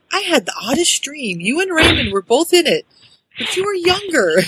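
high, dreamy voice for Miriam; Miriam quote. Retold or imitated. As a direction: raise the voice to play the wife recalling a dream. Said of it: "Miriam saying to Dora".

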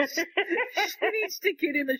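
laughing through the words. Real, not acted. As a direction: giggle while you say it. Laughing after reading quote.

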